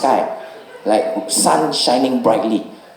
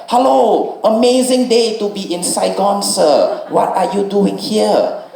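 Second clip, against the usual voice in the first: much higher, bright singsong greeting, slowing down at the end; cheery Vietnamese bike rider; 9 semitones higher, slower than usual. Retold or imitated. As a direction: play the overly friendly driver chirping hello. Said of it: "He was like".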